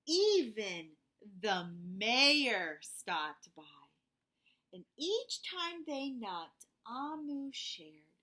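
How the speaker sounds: put-on gentle read-aloud for kids; storybook narration. A narrator's reading. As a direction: tell it like a bedtime story. Reading picture book to children.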